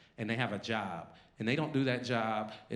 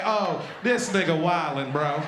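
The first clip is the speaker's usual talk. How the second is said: marchers complaining; 4 semitones higher, 7 dB louder than usual. Retold or imitated. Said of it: "They're like".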